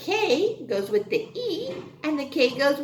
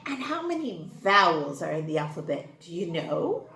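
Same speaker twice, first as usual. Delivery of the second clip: normal talking voice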